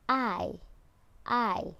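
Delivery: I drawn out with the jaw dropped; long I sound. Shown as a demonstration. Teacher showing pupils the long I sound. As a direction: open wide on I. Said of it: "the long I".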